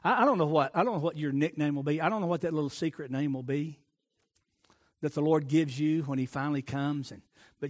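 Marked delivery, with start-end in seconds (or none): none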